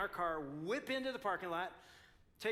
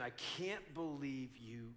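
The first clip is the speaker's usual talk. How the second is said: softer, lower, slow seething complaint; a hint of quiet fury; low mock seething; 6 semitones lower, 6 dB quieter, slower than usual. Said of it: "I start saying".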